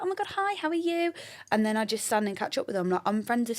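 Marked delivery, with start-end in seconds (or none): 0.0-1.1 high, sing-out friendly hello; excited greeting back. Retold or imitated. Her greeting someone she knows, excited high voice. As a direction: mimic greeting a pal, voice way up.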